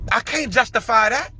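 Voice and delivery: normal talking voice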